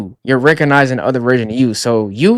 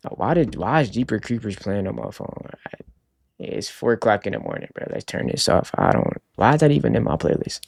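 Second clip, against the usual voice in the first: hushed; 8 dB quieter than usual. Real, not acted. Phone plays music at 4am.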